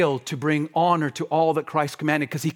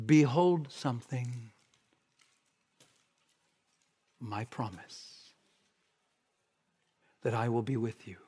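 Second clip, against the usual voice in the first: hushed reverence; 5 semitones lower, 7 dB quieter than usual. Real, not acted. Preacher reciting Christ's last promise to the church, hushed. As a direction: let each phrase of the promise sink in softly.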